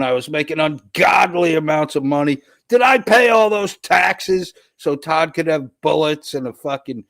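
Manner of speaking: hammed-up indignant shouting, high; mock outraged rant. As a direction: rant like a furious taxpayer. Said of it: "Sketchy Clown says".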